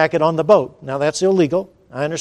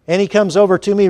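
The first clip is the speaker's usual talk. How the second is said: ordinary talk, nothing marked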